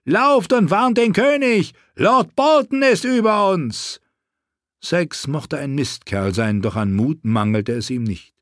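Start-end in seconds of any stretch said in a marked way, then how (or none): 0.1-4.0 screaming. A narrator's reading. Knight screaming at captive to flee and warn the king. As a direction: scream at her to run. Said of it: "schrie Sir Clayton".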